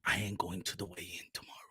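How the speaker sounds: put-on very low, very soft African-accented boxer; barely audible, grim boxer voice; ominous hushed confession. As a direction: brood it quietly into the phone. First take, ominous low confession.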